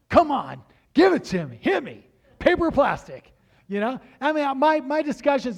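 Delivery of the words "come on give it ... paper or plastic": loud, high, hammed-up cashier pitch; mock eager. Cashier mockingly hyping the same question, loud. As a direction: beg for the answer like a game show host. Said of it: "ask people the same question over and over".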